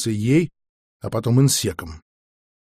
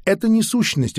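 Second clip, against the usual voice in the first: higher, firm interruption; cutting him off; 8 semitones higher than usual. A narrator's reading. Di interrupting the academic's claim, cutting him off. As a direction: cut the academic short. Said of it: "оборвал его Ди".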